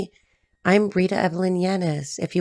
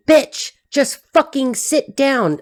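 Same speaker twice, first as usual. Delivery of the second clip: higher, slow, drawn-out exasperation; fed-up order, pitched up; exasperated outburst; 6 semitones higher, slower than usual. Acted. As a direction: snap with each word stretched out. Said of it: "I feel like saying".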